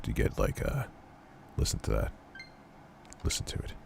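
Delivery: croaky sick voice, real. Real, not acted. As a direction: let the sick croak show through. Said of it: "you hear my voice is starting to get".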